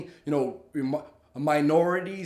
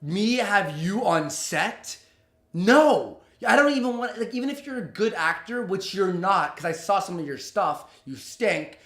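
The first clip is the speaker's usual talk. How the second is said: furious inner retort; 3 semitones higher, 4 dB louder than usual. Retold or imitated. Telling the producer off in his head, loud and furious. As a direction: spit out the contempt. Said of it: "in my head, I'm like".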